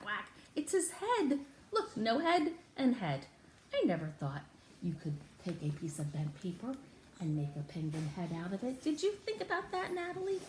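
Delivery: ordinary talk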